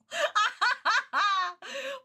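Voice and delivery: high peals of laughter. Real, not acted. Streamer cracking up at imagined villain, laughing. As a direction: burst out cackling high.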